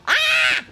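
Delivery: sharp high yelp; a hammed-up shriek; mock dramatic cry.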